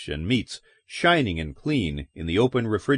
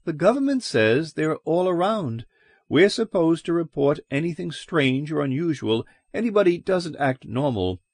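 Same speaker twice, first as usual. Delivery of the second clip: higher, earnest small-town landlady; wary landlady; 6 semitones higher than usual. A narrator's reading. Landlady explaining Red suspicions to stranger, raised earnest voice. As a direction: warn him, half apologetic.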